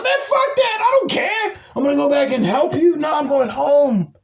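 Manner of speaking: high-pitched, indignant refusal; outraged squawk, voice shooting way up; exasperated rant. Real, not acted. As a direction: refuse in a worked-up, rushing yell. Ranting on, refusing to help.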